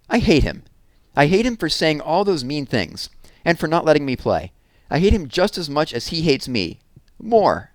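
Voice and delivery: loud, high, hurt declaration of hate; furious child's outburst; angry outburst. Acted. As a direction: spit out the hate at mom. Jessie's outburst at mom.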